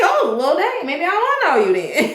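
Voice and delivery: playful comeback through a chuckle; laughing mock retort. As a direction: toss it back half-laughing. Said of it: "I'm like".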